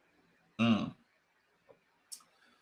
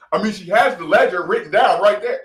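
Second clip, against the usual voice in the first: emotional rant; 8 dB louder, faster than usual. Real, not acted. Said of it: "Sorry for getting emotional".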